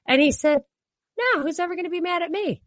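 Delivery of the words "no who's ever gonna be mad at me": high, quick, imitating blithe confidence; imitated carefree dismissal. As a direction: toss it off as a carefree mimic. Acts out carefree rich man's reply.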